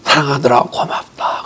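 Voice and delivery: 사랑하더라, 고맙다 breathed out in a soft whisper; quietly whispered blessing; hushed whisper. Retold or imitated. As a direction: let the words fall to a whisper, like a voice from above. God's words, softly retold.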